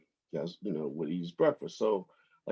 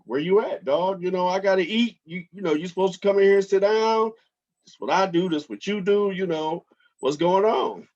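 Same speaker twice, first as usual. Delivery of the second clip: voicing the bird; 10 semitones higher, 5 dB louder than usual. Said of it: "he's like".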